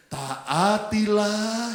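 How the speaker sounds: very slow, stretched-out syllables; unison scripture reading. Real, not acted. Preacher reading the verse with the congregation, drawn-out recitation. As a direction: lead the whole room in reading aloud.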